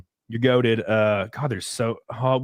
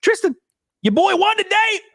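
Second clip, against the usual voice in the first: excited shout-out; 4 dB louder than usual. Real, not acted. Celebrating the race win.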